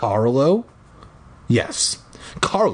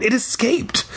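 crying out; 11 semitones higher than usual. Acted. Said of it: "I cried".

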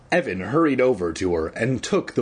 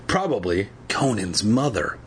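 usual speaking voice

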